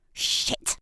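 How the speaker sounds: normal talking voice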